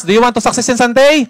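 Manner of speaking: rally the room with a bigger voice. Shown as a demonstration. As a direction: hype question thrown at the crowd. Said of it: "Yung malakas".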